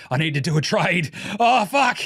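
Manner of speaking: high frantic excited rant; excited Presti. Retold or imitated. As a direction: squeal it out, giddy and high. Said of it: "He's like".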